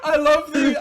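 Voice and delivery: squeaky laughing voice; breaking into laughter. Real, not acted. Cracking up mid-sentence.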